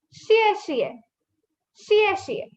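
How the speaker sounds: "xie xie" high, then a sudden drop; falling fourth tone. Shown as a demonstration. Teacher reviews thank you, high falling "xie xie". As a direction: pitch "xie xie" high and let it fall. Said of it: "sudden falling tone".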